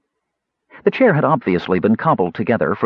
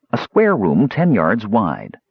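ordinary delivery

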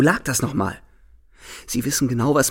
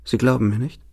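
usual speaking voice

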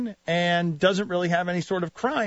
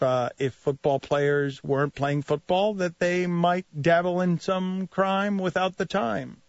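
ordinary delivery

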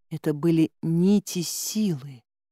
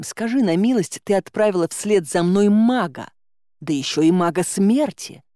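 ordinary delivery, nothing marked

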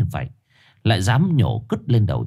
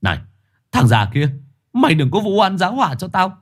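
angry retort; 11 semitones higher than usual. Acted. Chồn shouting at the lying shaman, furious. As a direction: strain your neck and shout back. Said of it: "Chồn gân cổ cãi".

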